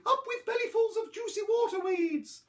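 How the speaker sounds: very high duckbill hoot; high dinosaur cheer. A narrator's reading. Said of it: "they hooted".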